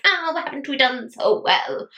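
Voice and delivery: slow, smug, self-congratulating coo; mock-proud sigh over a new box; smug self-praise. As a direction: purr it like you're stroking a prize. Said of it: "being like".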